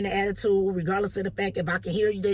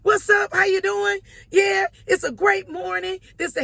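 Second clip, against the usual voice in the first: very high, loud shouted greeting; matching loud cheer; 13 semitones higher, 7 dB louder, slower than usual. Retold or imitated. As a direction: throw the same energy right back. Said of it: "came back on him same way".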